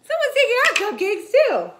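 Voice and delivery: high, loud and fast alarm. Acted. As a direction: blurt it out in shock. Alarm at missing cupcakes.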